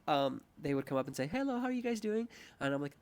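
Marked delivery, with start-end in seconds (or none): 1.3-2.3 high, bright server greeting; lightly mocked restaurant server hello; chirpy waiter. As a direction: greet the table like a peppy waiter. Imitating waiter greeting table.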